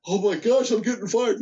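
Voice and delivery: mock alarm. Voices employee's alarm at being fired.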